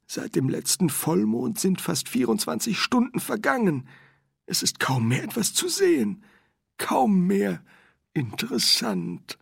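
high-pitched character voice, thinking to himself; high voice, slowing on the last word; musing Windel. Acted. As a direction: muse privately in a high, put-on voice.

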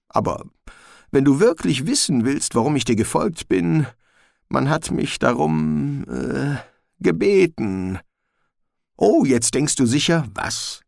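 high, nervous little dog; a jittery mongrel pleading; talking dog voice. Acted. Gespode the dog speaks.

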